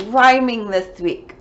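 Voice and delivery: ordinary delivery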